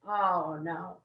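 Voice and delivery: low, fading oh, no in mock dread. [name] realizing the sponsor will make her recruit, low mock dread. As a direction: groan it out deep, caught out. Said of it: "And I went".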